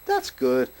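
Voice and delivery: high, fond praise for the dog. Real, not acted. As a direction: talk up to the pup.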